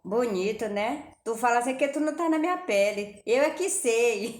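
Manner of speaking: resposta mais alta, irritada; irmã retrucando brava. Retold or imitated. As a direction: imitando a irmã indignada. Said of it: "ela só olhava assim pra mim". (irmã retrucando, mais alto e brava)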